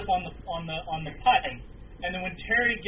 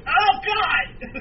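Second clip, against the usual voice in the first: sudden high yelped cry, louder; pained yelp; 14 semitones higher, 7 dB louder than usual. Retold or imitated. Wrestler breaking character after a laptop to the head, yelping. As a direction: yelp like the blow really hurt. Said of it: "just goes".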